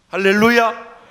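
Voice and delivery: loud, ringing hallelujah; hallelujah shout. Real, not acted. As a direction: shout it out to the congregation. (크게 외치는 할렐루야)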